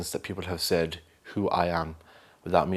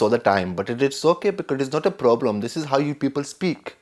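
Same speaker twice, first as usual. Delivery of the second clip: mock Pakistani accent; 6 semitones higher, 3 dB louder than usual. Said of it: "somebody who talks like this".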